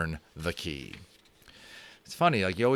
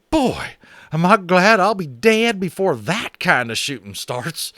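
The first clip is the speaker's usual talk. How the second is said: feverish cowboy; 10 semitones higher, 8 dB louder than usual. Acted. Boone relieved he'll miss future wars, feverish loud cowboy. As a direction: blurt it in a fevered cowboy rush. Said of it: "he said feverishly".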